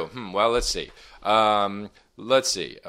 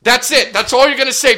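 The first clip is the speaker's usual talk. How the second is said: much higher, louder shout; 14 semitones higher, 10 dB louder than usual. Real, not acted. [name] yells at Brian that's all he gets to say, loud. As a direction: yell them down.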